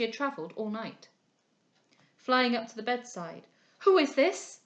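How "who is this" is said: alarmed cry. A narrator's reading. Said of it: "she cried".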